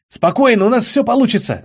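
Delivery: confident cut-in. A narrator's reading. Charlie cuts in confidently.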